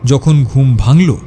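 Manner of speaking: usual speaking voice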